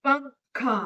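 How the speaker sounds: पंखा said with the nose held closed; nose pinched shut. Shown as a demonstration. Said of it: "नाक को बंद करके बोलने की कोशिश". (नाक बंद करके पंखा)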